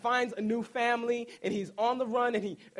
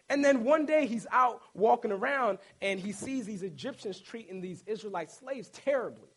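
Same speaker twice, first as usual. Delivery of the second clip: ordinary talk, nothing marked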